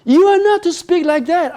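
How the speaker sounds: sharp high scolding rebuke; angry. Acted. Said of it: "I said angrily".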